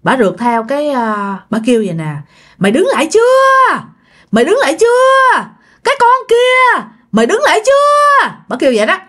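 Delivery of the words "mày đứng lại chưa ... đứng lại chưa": acted shriek of a pursuing hag; ghost hag yelling. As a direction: yell after a fleeing victim as a ghostly old woman. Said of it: "thấy rằng bà la làng".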